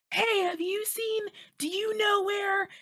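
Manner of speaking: high-pitched pretend shouting, not truly loud; mock yell. Shown as a demonstration. Demonstrating how to ask around a noisy bar, mock yelling. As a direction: call out slowly, way up high, faking a yell. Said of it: "yell really loudly into people's faces".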